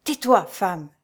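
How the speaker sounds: restrained, intense order to hush; low, vibrant command. A narrator's reading. Monk stops the fleeing woman, low vibrant command. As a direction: order silence under tension, without shouting. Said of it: "lui dit-il d'une voix basse et vibrante".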